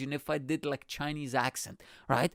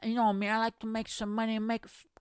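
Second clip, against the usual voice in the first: put-on higher-pitched Sopranos-style Italian-American accent; Tony D'Angelo; 7 semitones higher than usual. Wrestler describing his shady business, mock Italian-American accent. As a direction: caricature Italian-American wise guy. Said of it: "He literally sounded like that".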